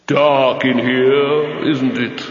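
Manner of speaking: slow, hollow voice in the dark; drawn out, close to his ear; Death speaking. A narrator's reading. Death speaks into the sealed box, slow and hollow. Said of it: "a voice has just said".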